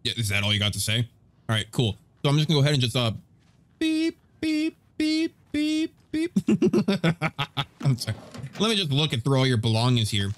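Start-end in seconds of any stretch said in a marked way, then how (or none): 3.8-6.3 high, even truck-reversing beeps; high-pitched vocal beep; imitated beeping. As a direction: beep like a vehicle backing up. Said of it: "listen for the beeping sounds".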